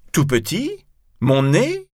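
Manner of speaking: high, rising incredulous question; indignant echo. Acted. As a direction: throw the words back, affronted. Cyrano indignantly echoes the answer.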